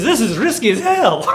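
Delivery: hammed-up Scottish shout, pitched high; loud mock Scottish brogue; crappy Scottish accent. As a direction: shout it like a badly faked Scotsman. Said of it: "this is my crappy Scottish accent".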